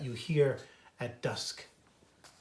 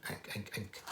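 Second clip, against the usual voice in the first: lower nuthatch call; 5 semitones lower, 12 dB quieter than usual. Shown as a demonstration. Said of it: "instead of".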